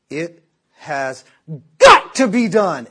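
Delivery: through clenched teeth, punch "got" hard; emphatic dad. Retold or imitated. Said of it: "His teeth would come together".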